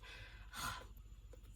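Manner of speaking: long soft sigh; real, hushed exhale; lost-for-words sigh. Real, not acted. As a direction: sigh quietly, searching for words. Struggling for words to describe.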